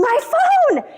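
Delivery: high-pitched mock scream, drawn on the last word; retold scream. Speaker re-enacting losing a phone in the river, mock panicked scream. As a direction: cry out in panic, pitch leaping way up. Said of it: "then I scream".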